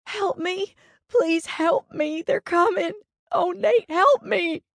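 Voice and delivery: high-pitched desperate crying plea for help; frantic, high, tearful cry; sobbing plea. A narrator's reading. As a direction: beg for rescue through panicked sobs. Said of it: "she sobbed".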